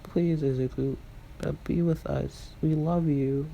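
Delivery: mild acted plea; pleading. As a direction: gently beg a brother to come back. Siblings beg Izuku to return.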